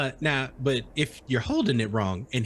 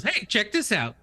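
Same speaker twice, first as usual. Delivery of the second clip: playful character voice; 8 semitones higher than usual. Acted. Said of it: "and he goes".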